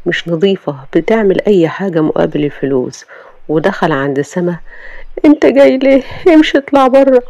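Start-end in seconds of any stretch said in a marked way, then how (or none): 5.2-7.2 high, loud voice ordering him out; young wife throwing her husband out of the room; angry young woman. Acted. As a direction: snap at him to leave. Sama throws Sameh out.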